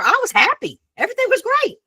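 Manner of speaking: high, sing-out cheeriness; happy. Retold or imitated. Speaker recalling her mood before the store, happy and high. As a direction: pitch it way up, gleefully upbeat.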